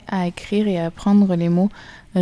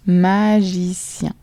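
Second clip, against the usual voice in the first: slow dictation; 3 dB louder, much slower than usual. Shown as a demonstration. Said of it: "Donc, trois syllabes".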